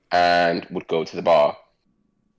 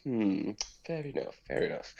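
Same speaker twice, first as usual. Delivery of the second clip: musing murmur; 14 dB quieter than usual. Acted. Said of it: "and he goes".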